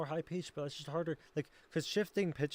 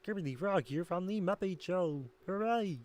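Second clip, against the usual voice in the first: higher-pitched puppet frog voice; Kermit the Frog; 4 semitones higher than usual. Shown as a demonstration. Streamer attempting a Muppet impression, higher puppet frog voice. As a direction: announce it like a cheery puppet frog. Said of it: "I can do".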